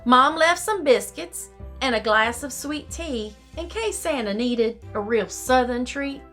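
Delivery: ordinary delivery, nothing marked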